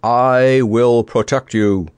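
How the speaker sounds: loud, slow, knightly vow; grand boom. A narrator's reading. Sir Hokus vows to guard his friends, booming grandly. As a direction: proclaim it grandly, drawing out each word. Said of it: "boomed Sir Hokus grandly".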